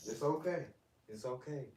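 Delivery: loud, high calls to calm the dog; loud soothing. Real, not acted. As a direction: call it out loud to settle a crying pup. Soothing a crying dog loudly.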